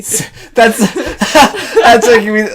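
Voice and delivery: high giggling through words; laughing. Real, not acted. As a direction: crack up while talking. Cracking up at the pun.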